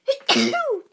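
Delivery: loud, high explosive sneeze-like burst; imitated faucet blast. Narrator imitates water roaring from faucet, explosive.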